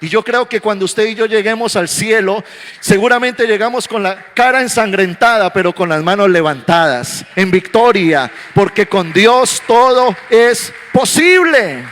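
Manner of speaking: triumphant preaching, slowing on the last line; preaching at full force. Acted. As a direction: lift the whole church to victory. Preaching climax about heaven.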